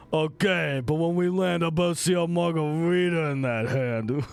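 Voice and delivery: cartoon buff-boy voice; Ha Ha You Clowns boys. One of the kids pushing Dad to drink, cartoon voice. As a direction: play a big animated son egging Dad on. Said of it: "one of the kids goes".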